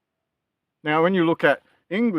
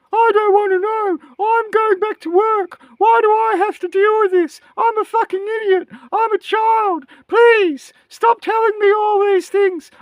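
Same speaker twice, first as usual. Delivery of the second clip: high, whiny mocking voice, much higher; mocking whiny voters. Mocking apathetic Australian voters to his audience, high whiny parody voice. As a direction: sneer at the lazy voters in a squeaky put-on whine. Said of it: "acted like a bunch of".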